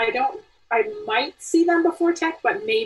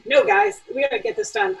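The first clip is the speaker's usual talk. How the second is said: mildly stern, raised pitch, firm push; 7 semitones higher than usual. Acted. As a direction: lightly act a teacher calling the group to order. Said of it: "I might be stern".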